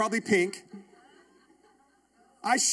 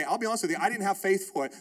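usual speaking voice

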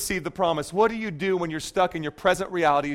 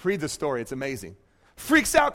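normal talking voice

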